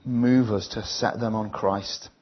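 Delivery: slow, earnest prayer read line by line. Acted. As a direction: pray it slowly, leaving room after each line. Leads the congregation in prayer.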